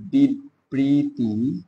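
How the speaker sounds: বিবৃতিমূলক drawn out very slowly, syllable by syllable; slow dictation. Shown as a demonstration. Teacher dictating বিবৃতিমূলক to students, very slow. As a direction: spell out বিবৃতিমূলক slowly so students can write it.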